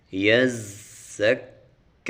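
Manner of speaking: یزکا with hissing z and long ka; یزکا, z whistled, final ka stretched. Shown as a demonstration. Teacher demonstrates correct یزکا, slow and loud. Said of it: "زا کے اوپر سیٹی نکالنی ہے".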